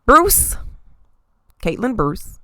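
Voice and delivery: ordinary talk, nothing marked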